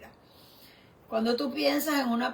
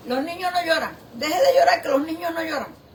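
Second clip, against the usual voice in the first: a hint of a stern mother, higher and louder; mock-scold a crying little boy, half laughing; hammed-up mother telling a child to stop crying; 5 semitones higher, 5 dB louder, faster than usual.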